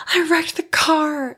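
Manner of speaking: high-pitched hysterical wail, drawn out slowly; mock hysterics. Daughter in tears to her waiting family, mock hysterical. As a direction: wail it out, imitating your hysterical younger self. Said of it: "I'm, like, hysteric".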